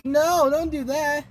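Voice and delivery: high, drawn-out plea; mock protest. Acting out plea to Joe.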